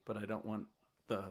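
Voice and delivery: normal talking voice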